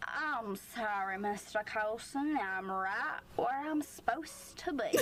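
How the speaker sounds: slow, playful Southern drawl; mock a silly Southern gentleman's accent; hammed-up Southern accent. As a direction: stretch it out in exaggerated Southern. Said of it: "quirky guy who likes to do little accents".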